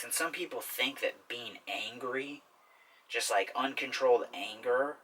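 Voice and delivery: ordinary delivery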